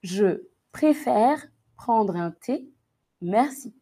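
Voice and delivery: slow, carefully spaced words; slow dictation. Shown as a demonstration. Teacher dictating the sentence to students, slowly. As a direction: dictate it word by word for the class.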